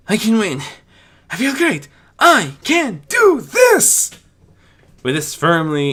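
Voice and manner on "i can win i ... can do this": very high, loud, triumphant shouts; shouted mantra. Acted. Spike shouting his mantra to encourage himself. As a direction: yell it to pump yourself up. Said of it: "a mantra to encourage himself".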